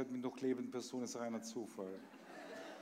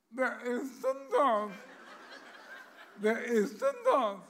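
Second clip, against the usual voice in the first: higher parrot squawk, slowed; 12 semitones higher, 10 dB louder, slower than usual. Acted. Parrot calling through the door at the visitor, squawky. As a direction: play the parrot behind the door. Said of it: "hört er drin im Zimmer was".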